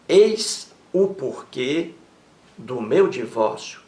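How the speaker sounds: very slow "Eis o porquê" with a rising "o"; stress on o porquê. Shown as a demonstration. Teacher highlights the article before "porquê". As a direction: stretch out "o porquê", lean on the article. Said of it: "você colocou um artigo antes do porquê".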